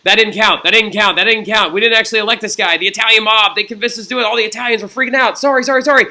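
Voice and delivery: loud, high, hammed-up panic; panicked backpedalling. Cardinals excusing themselves after electing Urban, hammed-up panic. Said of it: "in fear for their jobs".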